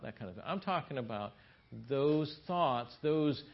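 ordinary talk, nothing marked

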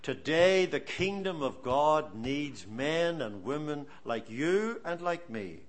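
ordinary delivery

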